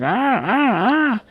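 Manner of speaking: high, rising squawks like a startled bird; three high yelping ahs; kiwi screams imitated. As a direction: copy the kiwi's shrill screams. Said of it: "It's like".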